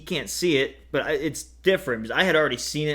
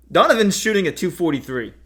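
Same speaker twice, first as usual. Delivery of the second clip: ordinary delivery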